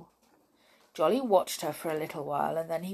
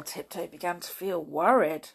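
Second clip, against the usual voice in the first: ordinary delivery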